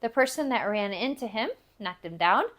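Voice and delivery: ordinary delivery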